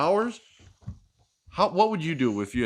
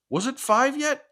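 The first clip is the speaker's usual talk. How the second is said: usual speaking voice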